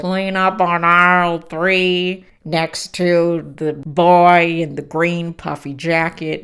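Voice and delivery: slow, loud store-speaker voice; intercom announcement. Retold or imitated. Store clerk over the PA to shoppers, slow loud announcement.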